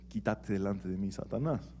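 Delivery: softly, as if said privately in his ear; quiet aside. Shown as a demonstration. Jesús quietly rebukes Pedro in his ear, a soft murmur. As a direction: murmur it low, just for Pedro. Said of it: "Jesús no le dijo quedito o calladito al oído".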